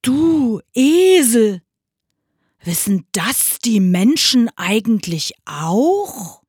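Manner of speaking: big booming ox, puzzled; questioning ox. Acted. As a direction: call out ponderously to the donkey. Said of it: "fragte er den Esel".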